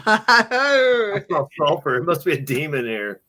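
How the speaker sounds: lightly voiced paranormal host; mock ghost hunter. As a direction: play the spooked investigator. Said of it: "something you would say".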